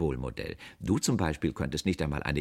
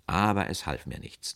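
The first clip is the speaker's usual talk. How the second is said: ordinary delivery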